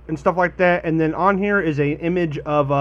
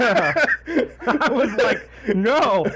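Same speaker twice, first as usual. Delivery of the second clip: laughing; 9 semitones higher, much slower than usual. Real, not acted. Laughing at short review.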